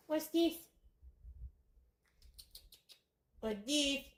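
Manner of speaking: put-on high sing-song baby talk; cat talk. Owner to her cat, high baby talk.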